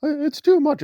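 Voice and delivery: high, whiny mock sob voice; mock whining. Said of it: "when you're crying and whining, say".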